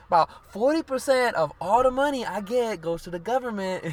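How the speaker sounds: put-on [name]'s Dominican New York accent, high and loud; imitating [name]. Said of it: "the way in her accent and shit".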